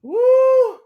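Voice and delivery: high, loud, drawn-out woo; loud whoop. Real, not acted. Recovering from a viewer's comment, loud whoop. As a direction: whoop to let it all out.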